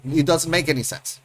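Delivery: ordinary talk, nothing marked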